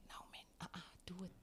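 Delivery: hushed whisper of a nagging inner voice. Retold or imitated. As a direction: whisper it like a voice in your head. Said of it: "that thing in your brain here at the back".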